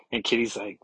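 normal talking voice

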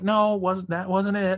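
high, loud imitation of her denial; flustered woman, imitated. Imitates woman answering through door.

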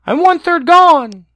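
tiny high squeaky wail; gingerbread man voice. Acted. Gingerbread man being eaten.

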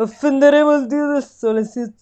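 a half-hearted stab at a prime minister's voice; Giuseppe Conte impression. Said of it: "no, non so capace".

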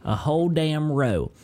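slow, drawn-out Southern storytelling; Southern accent. Pretend. Host listing gas station snacks, slow Southern accent. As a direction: stretch the vowels like a country storyteller. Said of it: "start leaning into the Southern accent".

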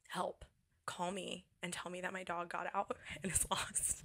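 whispered urgent request; whispering to a friend to fake an emergency; hushed plea. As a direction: murmur it so she can't hear. Secret text for escape call.

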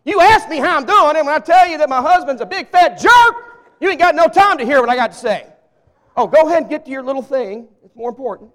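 loud hurt indignation, mock; offended church member. Church member miffed that the preacher rushed off, mock indignant. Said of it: "they're thinking".

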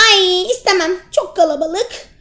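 high, picky squirrel; fussy refusal. Acted. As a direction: turn it down with a huff. (nazlı sincap sesi)

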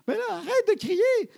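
very high, loud, drawn-out mock shriek; teen yelling. Daughter orders father to stop yelling, exaggerated shriek. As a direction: screech it like an outraged teenager. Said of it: "elle avait dit ça".

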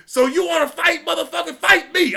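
high, fast, angry yelling; furious coach. Retold or imitated. Coach cussing the kid out, high angry yell. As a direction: chew the kid out at full volume. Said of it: "he's like".

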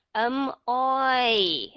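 "oi" glided from o to i; clear oi sound. Shown as a demonstration. Teacher introducing the oi vowel. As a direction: model "oi" as two sounds. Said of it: "Âm tiếp theo chúng ta có là âm oi".

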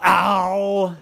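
drawn-out comic "ow"; cry of pain. A narrator's reading. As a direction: moan it out after bumping a wall. Daddy hits another wall.

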